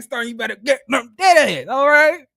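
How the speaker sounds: loud hyped-up cheer; hype shout. Retold or imitated. Retelling her reaction, shouting encouragement at Stormy.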